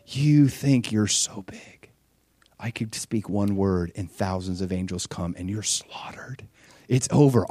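slow, threatening whisper; menacing whisper. Retold or imitated. Jesus on the cross taunts his mockers, slow menacing whisper. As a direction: hiss the threat at the mockers under your breath. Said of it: "in my carnal mind, I'd be up there being like".

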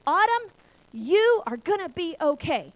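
high, loud, stretched-out calling voice; yelling down. Retold or imitated. Father calling down to daughter stuck in snow, yelling. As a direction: holler it down slowly and firmly. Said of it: "he yells over to me".

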